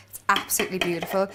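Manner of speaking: ordinary talk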